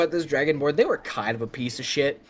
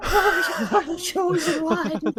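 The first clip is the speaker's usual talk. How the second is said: much higher, sobbing hammed-up awe; mock crying goblin; 14 semitones higher, slower than usual. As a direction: wail it out like a worshipper in tears. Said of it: "Immediately falls to its knees crying".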